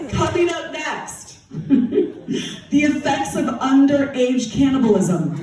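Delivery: slow, giggly newsreader blunder; mock news read, laughing; news read slip. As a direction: read it slowly, laughing through it. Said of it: "Instead, she said".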